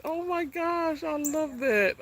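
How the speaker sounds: very high, delighted real squeal; overjoyed. Real, not acted. Vlogger sees the bird come into view, overjoyed squeal. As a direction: squeal with sudden joy.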